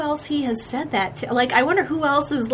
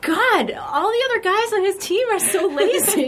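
laughing mock complaint; 9 semitones higher than usual. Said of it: "And I'm sitting there in the stands like".

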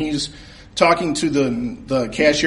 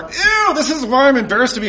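much higher, squeamish ew; disgusted ew; 10 semitones higher than usual. Real, not acted. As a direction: recoil at the creep. Disgusted by the flirting customer.

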